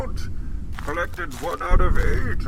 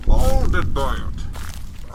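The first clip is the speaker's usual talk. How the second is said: slow dramatic; much slower than usual. Acted. Bane's dramatic monologue quote.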